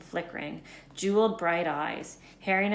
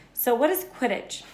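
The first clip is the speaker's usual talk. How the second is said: put-on young boy voice; 6 semitones higher, 5 dB louder than usual. Harry voiced.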